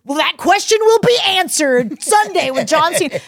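loud, high mock fight-promo announcer; imitating a booming hype man; telephone ad announcer. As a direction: belt it out as an over-the-top ad. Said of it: "it's, like, a telephone ad".

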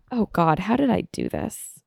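ordinary delivery

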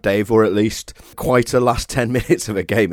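ordinary talk, nothing marked

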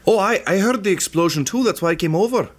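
high put-on gnome voice, eager; gnome NPC voice. Peachtree explaining herself to the party, high and eager. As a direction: answer quickly, a bit too eager.

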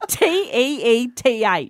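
slow, letter-by-letter spelling T E E T H; spelled out slowly. Shown as a demonstration. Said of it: "spelling the word out".

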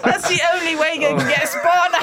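cracking up mid-sentence; laughing. Real, not acted. Giggling punchline, laughing loud.

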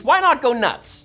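slow, high and loud, going wild; manic excitement. Acted. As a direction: egg everyone on to go nuts. Building up a wild joke.

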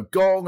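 a sung-out gong bong; imitated gong. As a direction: boom it like the show's gong. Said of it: "this gong would go".

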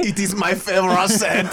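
high, put-on French accent. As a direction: agree as a giggly mock Frenchman. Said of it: "we are talking in French".

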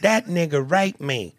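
put-on quoting a Southern girl telling her daddy. Listener quoting the Southern girl bit, lightly voiced. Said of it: "in the Southern girl's voice".

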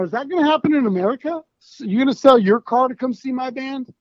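incredulous questioning. Retold or imitated. Musician doubting American kids would sell cars, incredulous. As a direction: voice a thought in mock disbelief. Voices his skeptical thought about America.

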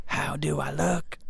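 slow eerie whisper; ghostly whisper. Acted.

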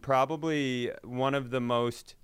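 ordinary talk

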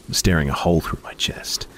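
ordinary delivery, nothing marked

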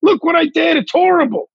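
high-pitched, begging mock outcry; mock pleading. Comic begging the public to cancel him, high mock plea.

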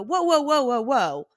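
high, loud alarmed whoas. Retold or imitated. Doctor and Michael startled by her on all fours, loud alarmed whoas. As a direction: stop her in sudden alarm.

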